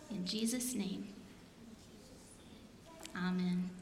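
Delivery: slow, paused line-by-line prayer. Acted. Pastor leading children in repeat-after-me prayer, slow and paused. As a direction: lead the prayer gently, line by line.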